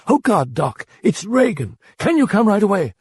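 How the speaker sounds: high, panicked plea on the phone; frantic mother begging the doctor to come; on the brink of hysteria; distraught, near hysteria. Acted. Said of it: "Her voice was distraught and on the brim of hysteria".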